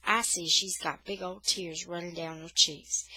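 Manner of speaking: ordinary delivery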